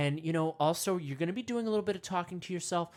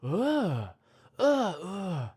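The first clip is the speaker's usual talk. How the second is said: guttural ape grunts, louder; monkey grunts; 2 semitones higher, 3 dB louder, much slower than usual. Retold or imitated. First monkey grunting to the others. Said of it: "one of them stands up and goes".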